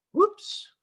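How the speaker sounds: sudden high yelp of surprise; surprised whoops. Real, not acted. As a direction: caught off guard, jump up high. Accidentally drew with brush tool.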